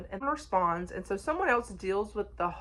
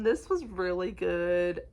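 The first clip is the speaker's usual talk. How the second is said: usual speaking voice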